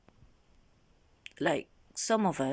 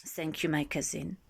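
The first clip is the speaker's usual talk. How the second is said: ordinary delivery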